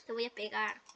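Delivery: soft repeat through laughter; laughing. Retold or imitated. Gamer repeats the mock threat, now softly laughing. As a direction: giggle the threat out quietly.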